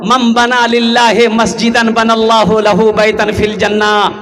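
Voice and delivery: measured Arabic recitation with drawn-out vowels; hadith recitation. Acted. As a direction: recite the sacred text evenly, holding the final syllable. (हदीस की ठहरी हुई तिलावत)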